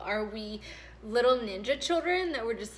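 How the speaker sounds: ordinary talk, nothing marked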